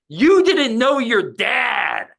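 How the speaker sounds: high, drawn-out mock heckle; retold heckler's yell. Imitating a man heckling the comedian mid-set, drawn-out yell. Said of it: "he yells out".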